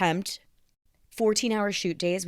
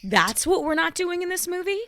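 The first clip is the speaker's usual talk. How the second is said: high, loud mock-incredulous outburst; mock incredulity; 13 semitones higher, 6 dB louder than usual. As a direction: play up loud, high-pitched disbelief. Mock reply to a film line.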